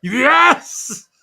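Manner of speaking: high, loud cheering yes; excited shout. Real, not acted. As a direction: shout it in sheer delight. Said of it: "cheering in delight".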